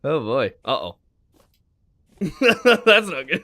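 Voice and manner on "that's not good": laughing. Real, not acted. Laughing after the chase.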